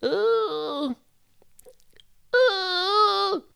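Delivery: put-on very high, drawn-out old lady falsetto; old woman. Reader finding an old woman's voice, piping high. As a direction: try out a hooting old woman. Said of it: "uh, an old woman".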